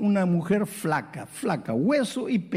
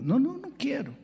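tearful refusal; 8 semitones higher, 4 dB quieter than usual. Retold or imitated. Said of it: "Y estaba llorando".

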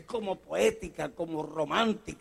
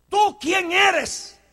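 slow shouted challenge; 11 semitones higher, 8 dB louder, much slower than usual. Acted. Preacher challenges congregation.

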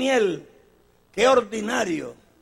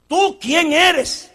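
slow insistent question; 8 semitones higher, 6 dB louder, much slower than usual. Acted. John questioned, loud take.

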